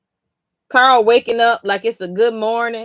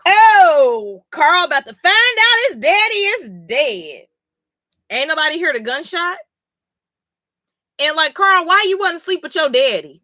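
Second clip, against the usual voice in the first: exasperated; 7 semitones higher than usual. Real, not acted. Streamer venting to chat about Carl, exasperated. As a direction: pile on the disbelief.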